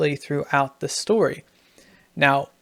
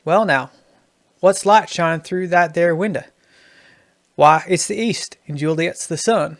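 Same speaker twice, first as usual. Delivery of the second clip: Southern accent; 3 dB louder than usual. Shown as a demonstration. Writing teacher demonstrating the first regional version, Southern accent. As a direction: put on a light Southern accent. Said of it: "going to be a Southern accent".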